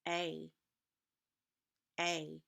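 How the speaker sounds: A said as a long, clear "ay"; teacher modeling the long vowel; long A sound. Shown as a demonstration. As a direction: stretch A into a full "ay". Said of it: "So the long A says".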